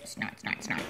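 breathy whispered snort sounds. A narrator's reading. Reading to a child, whispering the boar's snorts.

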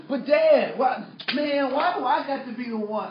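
loud, high pleading complaint; hammed-up imitation of an aggrieved kid; protesting teenager. Son begs dad not to pick him, protesting.

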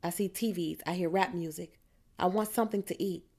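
usual speaking voice

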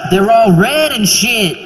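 lightly voiced rapper getting paranoid. Imagining [name] meeting a grapefruit, building to a high paranoid yell. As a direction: play him spooked by fruit. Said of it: "Is he just like".

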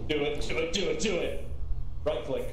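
loud urging chant; shouting. Real, not acted. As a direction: hype them up loudly. Egging on loudly.

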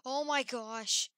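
loud startled cry, slowed; startled loud oh my gosh; frightened shout. Real, not acted. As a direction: yell it in sudden fright. Sudden fright at animatronic.

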